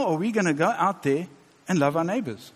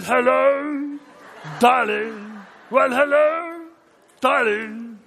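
raspy, much higher, slow crooning with a laugh in it; 8 semitones higher, much slower than usual. Retold or imitated. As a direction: croon it like a gravel-voiced jazz singer. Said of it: "He went something like this".

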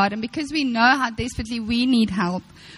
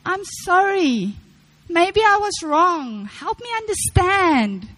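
slow, higher, gently humble apology; 6 semitones higher, slower than usual. Shown as a demonstration. Preacher modelling a humble apology for the sisters, slow and higher. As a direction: soften and slow down as you admit fault. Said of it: "We need to say".

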